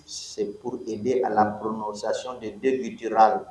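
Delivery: usual speaking voice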